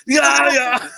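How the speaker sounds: hammed-up orgasmic yell; climax impression. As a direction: yell it like an over-the-top climax. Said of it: "what he looks like when he's coming".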